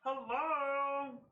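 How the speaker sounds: high, loud hallway hail; calling out. Acted. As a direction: call to people far off. Character calls down the hallway.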